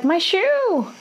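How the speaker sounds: high, sudden cry of my shoe; calling out. Retold or imitated. Drunk woman calling to the man who left, high cry. Drunk heroine calls after him.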